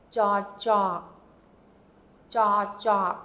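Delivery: "jaw, jaw" leaning on the second "jaw"; teacher's model with the second "jaw" emphasised; second-syllable emphasis. Shown as a demonstration. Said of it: "emphasis is on that second syllable".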